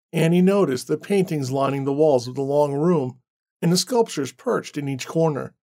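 usual speaking voice

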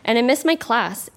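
usual speaking voice

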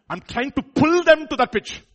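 loud, high and drawn out; straining emphasis. Acted. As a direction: haul the words upward, slowly and forcefully. Acts hauling singers up to pitch.

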